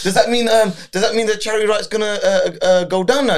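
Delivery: put-on high, fast, anxious child voice; worried kids' voice. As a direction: ask it like a worried kid. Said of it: "my daughters were going".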